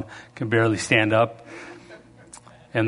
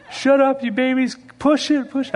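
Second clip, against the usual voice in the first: coach shouting; 12 semitones higher than usual. Retold or imitated. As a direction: drive the crying kids on. Coach yelling at kids.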